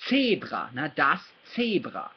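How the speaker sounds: "Zebra" said TSAY-brah; German pronunciation. Shown as a demonstration. Teacher repeating for learner, German TSAY-brah for "Zebra". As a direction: start "Zebra" with ts, German style. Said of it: "that's what a Z sounds like in German".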